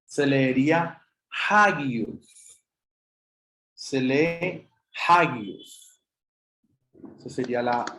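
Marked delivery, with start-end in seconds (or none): 1.5-2.6 "hagios" begun with a Spanish J sound; rough breathing. Shown as a demonstration. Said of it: "como si fuera una J". 5.1-6.0 "hagios" begun with a Spanish J sound; rough breathing. Shown as a demonstration. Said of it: "como si fuera una J".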